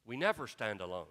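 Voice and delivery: low and soft we never stand alone; almost a whisper of assurance; hushed declaration. Real, not acted. Preacher assures the church: hushed. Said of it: "preacher's key line, hushed".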